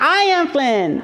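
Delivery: high, slow imitation of a child; little boy's announcement. Son revealing himself to grandma, imitated slow and high. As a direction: declare it slowly, high and proud. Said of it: "And he's like".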